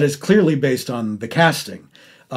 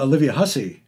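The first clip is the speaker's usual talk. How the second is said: usual speaking voice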